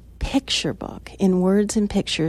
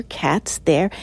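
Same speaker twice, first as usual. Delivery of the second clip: ordinary delivery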